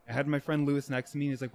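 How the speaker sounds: ordinary talk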